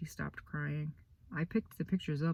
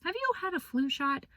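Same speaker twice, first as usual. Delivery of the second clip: much higher, fussing teacher voice; concerned teacher; 13 semitones higher than usual. Retold or imitated. Boy voicing Missus B asking about his coat, high fussy voice. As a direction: mimic a worried teacher.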